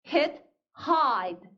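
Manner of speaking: hid with short i, then hide with long "aɪ"; contrasting vowel pair. Shown as a demonstration. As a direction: set "hid" and "hide" side by side, vowel clearly changing. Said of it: "쌍으로 한번 읽어볼까요". (짧은 i와 긴 아이를 대비해서)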